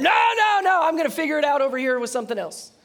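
loud, high, fast defiant no; stubborn refusal. Acted. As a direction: brush it off, shouting. Person refusing God.

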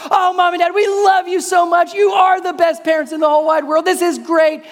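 a big excited shout of pure delight; thrilled kids. Retold or imitated. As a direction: gush like overjoyed little boys. Kids thrilled about movie surprise.

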